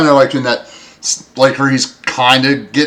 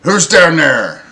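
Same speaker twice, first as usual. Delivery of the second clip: loud, raised call from the crow's nest; lookout's challenge; 6 semitones higher, 7 dB louder, slower than usual. Acted. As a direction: call out sharply to someone below. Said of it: "lookout from the crow's nest".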